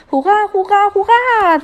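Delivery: very high, loud chirpy cheer; chirping. A narrator's reading. Rosalinde cheers the new king, chirping high and loud. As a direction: chirp the cheers like an excited little voice. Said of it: "zwitscherte Rosalinde".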